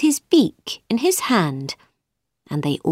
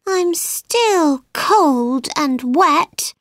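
put-on slow, high, miserable little girl; sad; 8 semitones higher, much slower than usual. A narrator's reading. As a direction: whimper it out, slow and sorry. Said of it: "Alice replied sadly".

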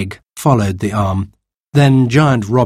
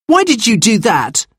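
angry cry; 12 semitones higher than usual. A narrator's reading. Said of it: "cried the baker's boy angrily".

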